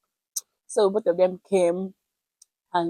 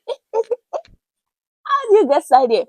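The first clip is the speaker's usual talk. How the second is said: surprised passer-by; 13 semitones higher, 8 dB louder, faster than usual. Retold or imitated. Passer-by shocked to find her.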